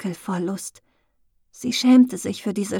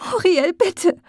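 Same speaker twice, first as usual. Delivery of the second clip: high, louder pleading cry; sobbing plea; 9 semitones higher, 5 dB louder than usual. A narrator's reading. Glenna begging Muriel after being pushed away, sobbing. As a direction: beg her through a sob. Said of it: "zuckte heftig zurück und schluchzte auf".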